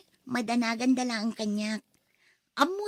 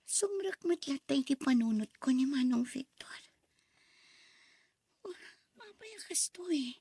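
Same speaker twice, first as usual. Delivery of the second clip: whispered thoughts; 4 dB quieter than usual. Acted. Inner monologue whispered thoughts.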